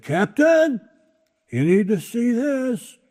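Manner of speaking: first mate spotting another ship; alarmed first mate. Acted. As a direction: sound the alarm.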